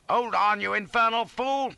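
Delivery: loud, high, exasperated shout; angry yell. A narrator's reading. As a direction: yell at a panicking fool. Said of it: "I yelled".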